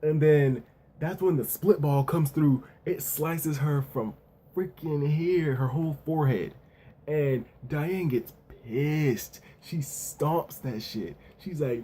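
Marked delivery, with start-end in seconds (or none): none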